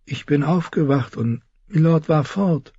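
high, drowsy woman's character voice; sleepy murmur. Acted. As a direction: murmur it as if just woken, pitched high. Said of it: "und murmelte schläfrig".

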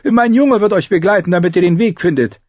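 high, quick innkeeper voice; hesitate, then chatter high to a customer; innkeeper character. A narrator's reading.

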